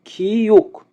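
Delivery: খিউক high, with aspirated kh; pronunciation demo. Shown as a demonstration. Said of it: "খিউকের উচ্চারণ হবে খ". (খিউক উঁচু স্বরে স্পষ্ট উচ্চারণ)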